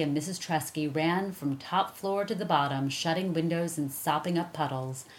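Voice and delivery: ordinary delivery, nothing marked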